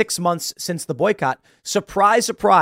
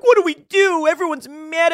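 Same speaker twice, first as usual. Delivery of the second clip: much higher, louder whiny panic; mock panic; 12 semitones higher, 4 dB louder than usual. Mocking Bud Light facing the left's boycott, in high panic. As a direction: squeal it like a panicked brand. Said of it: "and they're like".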